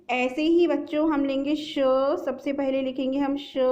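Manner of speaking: ordinary delivery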